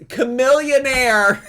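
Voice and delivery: loud, high, drawn-out exclamation with a laugh; excited outburst. Real, not acted. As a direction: shout the name as it comes back. Remembers singer, bursts out laughing.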